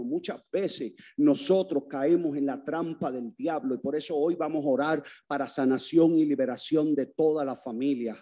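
ordinary delivery

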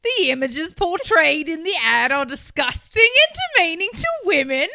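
put-on hard Outback accent. Cohost reads the complaint in a heavy Outback accent. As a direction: lay the Outback twang on thick. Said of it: "you went hard on the Outback".

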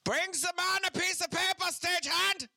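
loud, high German movie villain scream; German accent. Acted. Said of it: "do a German accent when they scream".

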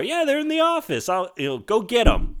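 put-on high, helpful little boy voice; kid voice. Retelling the movie, voicing Billy as a high eager kid.

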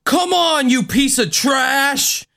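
high, slow hammed-up TV yell; cheesy TV ghost-hunter challenge; TV-style swearing. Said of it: "the P G swearing of Unsolved Mysteries".

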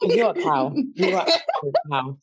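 high, playful jibe; mock insult. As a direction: tease her sharply.

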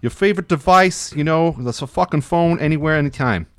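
lightly put-on Boston accent, reading an ad. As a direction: read the FAQ like a Boston local, only a light accent. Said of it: "read it in a Boston accent".

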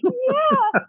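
very high mock-weepy wail; imitated crying. Admitting to her flatmate she's in the show, mock-tearful. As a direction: squeak a pretend-tearful admission. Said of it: "you're still crying like this".

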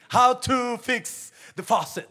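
high, loud, slow mock announcer; hammed-up YouTube tutorial read; mock how-to voice. Preacher mocking a YouTube how-to, loud and slow.

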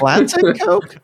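very high squeaky North Dakota grandma; squeaky sing-song North Dakota grandma. Retold or imitated. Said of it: "my grandma would ask me".

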